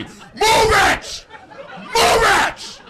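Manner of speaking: very high, loud road-rage scream; hammed-up enraged motorist. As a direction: scream it at the driver in front. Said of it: "starts screaming at me".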